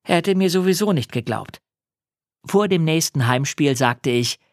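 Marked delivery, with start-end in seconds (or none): none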